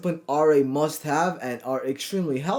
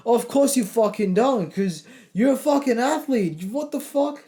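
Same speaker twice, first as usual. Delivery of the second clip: raised, higher-pitched rant, swearing hard; fired-up rant; 4 semitones higher than usual. Real, not acted. Coach ranting at viewers who fear their friends' teasing, fired up. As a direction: rip into the excuse-makers. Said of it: "I'm fucking sorry if I'm going off a bit".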